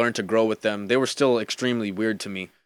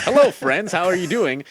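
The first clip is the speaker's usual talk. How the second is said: higher, cheerful proper greeting; proper friendly guy; 7 semitones higher, 4 dB louder than usual. Retold or imitated.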